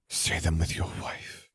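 breath-soft, near-whispered plea; murmured. A narrator's reading. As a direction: murmur it almost without voice. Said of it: "she murmured".

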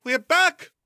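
high call up the stairs; ringing call. A narrator's reading. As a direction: sing out that you're home. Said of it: "A female voice rang up the stairs".

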